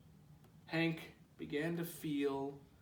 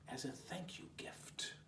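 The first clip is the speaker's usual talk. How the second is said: 1 whisper; 13 dB quieter than usual. A narrator's reading. Whispered narration.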